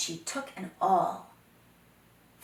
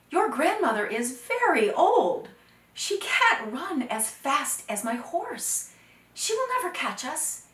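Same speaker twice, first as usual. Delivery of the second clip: confident boast; 7 semitones higher, 8 dB louder than usual. Acted. Dakota laughing off the danger.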